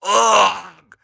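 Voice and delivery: loud grunt of pain; pained groan. Acted. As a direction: take the punch. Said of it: "Beastman groans".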